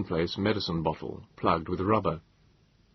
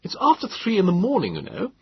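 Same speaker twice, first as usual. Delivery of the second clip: put-on irritated young man; 12 semitones higher, 3 dB louder than usual. A narrator's reading. Grumpy woken young man.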